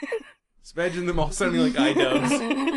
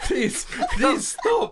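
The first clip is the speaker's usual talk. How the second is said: mocking demon plea; 7 semitones higher, slower than usual. Friends mimic pleading demon.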